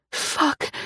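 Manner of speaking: usual speaking voice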